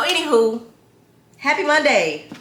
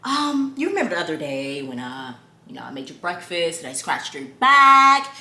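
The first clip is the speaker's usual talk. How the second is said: hesitant, mock-proper boyfriend, mostly lower; imitating Justin; 6 semitones lower than usual. Imitating boyfriend recalling favours to his girlfriend, haltingly proper. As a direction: work up to a complaint with careful, halting politeness. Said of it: "he talks really proper".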